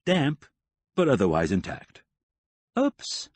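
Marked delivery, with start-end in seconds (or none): 2.8-3.3 much higher, caught out; a startled little oops; surprised oops. A narrator's reading. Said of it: "she said".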